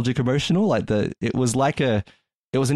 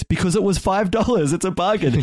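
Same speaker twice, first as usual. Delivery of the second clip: dad's pleased voice pitched higher; a dad bragging about a bargain, pitched up; proud dad; 10 semitones higher than usual. Retold or imitated. Dad tells his daughter about the bargain, proud and higher. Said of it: "He goes".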